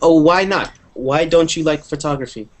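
usual speaking voice

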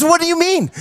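high, startled question; surprised teacher. Retold or imitated. Teacher startled by the packed bag, asking high and surprised. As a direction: caught off guard, pitch jumping up. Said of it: "She says".

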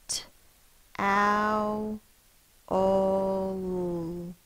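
"T, ow, ol" said as separate sounds; sounding it out. Shown as a demonstration. Teacher sounding out towel for the class, sound by sound. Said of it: "Sound it out".

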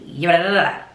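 mock rapid gibberish; imitated fast talk. Imitating coworker who talks fast, babbled nonsense syllables. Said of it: "he talk fast as hell".

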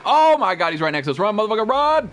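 high, urgent real warning; alarmed shouting. Real, not acted. Player warning teammate the creature is close, urgent shouting. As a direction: yell in alarm, climbing at the end. Creature suddenly right next to them.

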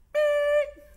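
long, loud, high-pitched beep; censor bleep. Retold or imitated. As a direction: blot out the swear word with a steady beep. Bleeps out a swear word.

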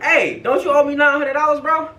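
high, pointed challenge; confronting. Retold or imitated. Storyteller accosts the scammer over nine hundred dollars, pointed challenge. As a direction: call him out as if catching him.

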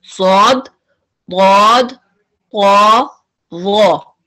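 सौद दौद तौ वो each drawn thick; heavy letter demo. Shown as a demonstration. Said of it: "वो four हैं".

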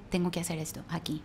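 an unvoiced whisper of private resolve; whispered to herself. Telling her husband what she whispered to herself, in a whisper. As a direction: breathe it out as a secret decision.

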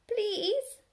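high, strained politeness; swallowing hard. A narrator's reading. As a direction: force the word out sweetly. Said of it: "swallowing hard".

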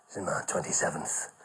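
put-on low, hushed old comic soothing a nervous lad; a low half-whisper, calming him down; low whispered reassurance from an old pro; Les Dawson reassuring. Les voiced.

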